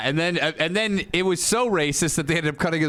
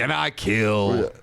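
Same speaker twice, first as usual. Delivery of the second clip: menacing wrestler; 8 semitones lower, much slower than usual. Retold or imitated. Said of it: "He wasn't like".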